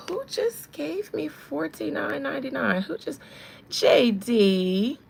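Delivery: loud, drawn-out exclamation; delighted surprise. Real, not acted. Streamer sees who gave the donation, delighted surprise. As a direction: call out the donor's initials, loud and astonished.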